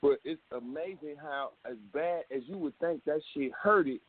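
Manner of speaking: ordinary talk, nothing marked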